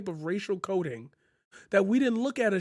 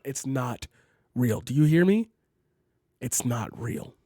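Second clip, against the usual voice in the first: talk-down tone; 6 semitones lower than usual. Real, not acted. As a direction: spell it out slowly for them. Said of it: "talk down to you tone".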